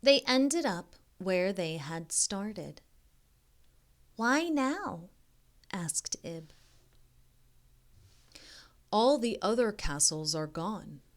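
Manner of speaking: slow storybook reading voice; story narration. A narrator's reading. Reading a picture book aloud to young kids, gentle storybook narration. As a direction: read it like a bedtime picture book.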